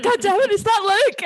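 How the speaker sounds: high-pitched retold outburst; panicked exclamation. Reliving a scare from the show for the hosts, panicked. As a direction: re-enact a startled outburst, pitched right up. Retelling a startled reaction to a scene.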